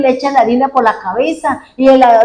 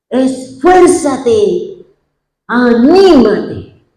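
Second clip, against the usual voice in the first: very slow, drawn out, every syllable weighed; solemn exhortation; much slower than usual. Acted. Preacher voicing Moses urging Joshua on, very slow and solemn. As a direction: deliver it like a command from Moses.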